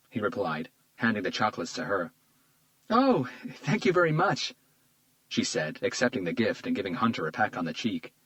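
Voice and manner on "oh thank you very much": high, slow grateful woman; sweet woman voice. A narrator's reading. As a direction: gush thanks sweetly.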